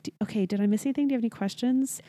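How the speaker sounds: fast talker. Retold or imitated. Mom finishing her update to cousin, rapid-fire. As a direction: race through the questions without a break. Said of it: "was going through that so fast".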